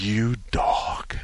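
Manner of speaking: breathy unvoiced whisper of mock scolding. Wife whispering a teasing scold at her husband, hushed. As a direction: hush it like a teasing jab.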